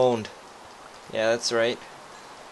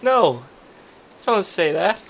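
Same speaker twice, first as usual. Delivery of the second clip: pleading; 8 semitones higher, 7 dB louder than usual. Acted. Begging not to be kicked out.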